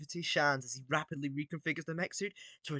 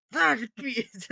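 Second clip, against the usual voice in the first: put-on stronger, higher villain accent; more Gru accent; 8 semitones higher, 5 dB louder than usual. Villain voice rehearsal, heavier accented take. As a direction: push the accent a bit further. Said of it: "it's a bit more".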